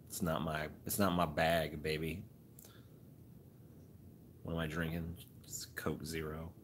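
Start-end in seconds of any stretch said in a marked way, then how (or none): 4.4-6.5 soft hushed answer; whispered. Real, not acted. Streamer whispering his drink to chat at night. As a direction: whisper it so no one wakes.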